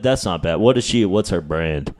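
normal talking voice

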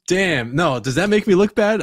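ordinary talk, nothing marked

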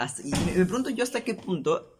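ordinary talk, nothing marked

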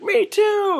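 high, loud squeal of joy; mock cheer. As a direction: yell it up high like a thrilled fan. Buddy cheers the same bet.

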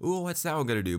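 hammed-up wondering at what comes next; mock anticipation. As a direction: play up the excited suspense. Eagerly acting out his anticipation.

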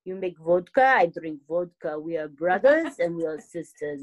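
put-on a playful, half-serious Russian accent. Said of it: "But I, I'll try it".

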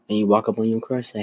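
normal talking voice

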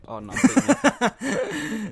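put-on loud, hammy Russian accent, ending high; mock Russian accent, climbing up. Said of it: "Russian accent".

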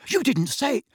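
sudden high alarmed cry. A narrator's reading. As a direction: blurt it out in alarm. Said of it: "looking alarmed".

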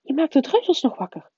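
high-pitched scolding from a professor; high, lightly hissed warning. A narrator's reading. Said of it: "siste professor Anderling".

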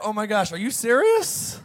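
hammed-up stunned reaction; mock disbelief. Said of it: "He said in his heart".

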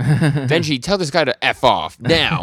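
loud, high mock demand; angry order. Nayata ordering Denji to dismiss Yoshida, mock angry shout. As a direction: order him loudly and slowly, imitating outrage.

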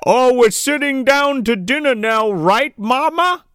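hammed-up megaphone call; mock megaphone. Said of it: "he's like".

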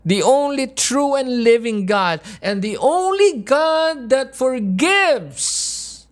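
high, impassioned pleading sermon; emotional full-force preaching. Real, not acted. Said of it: "I get a little bit, uh, emotional".

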